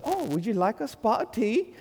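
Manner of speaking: high, hammed-up English accent; mock English accent. Pastor teasing an English teen girl, mock English accent. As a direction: tease in a put-on English accent. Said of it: "with this beautiful accent".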